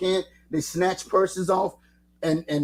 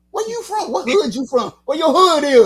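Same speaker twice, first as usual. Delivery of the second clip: street-tough greeting; 10 semitones higher, 7 dB louder than usual. Retold or imitated. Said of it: "oh, it's like".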